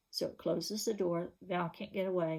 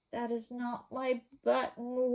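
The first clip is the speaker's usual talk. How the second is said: wail; 5 semitones higher than usual. Acted. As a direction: wail it like a hurt child. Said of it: "wailed Toad".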